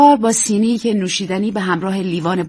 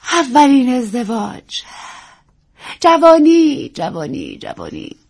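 theatrical fading sigh; 5 semitones higher, 3 dB louder, slower than usual. Acted. Actress muses to young wife on youth, theatrical fade. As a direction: let each word sink like a sigh.

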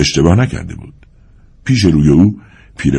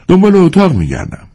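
brusque, pitched well up; 13 semitones higher, 3 dB louder than usual. A narrator's reading. Ardanif answers the Tatar man in the yard, brusquely. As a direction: say it sharply in a much higher voice. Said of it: "اردنیف تند گفت".

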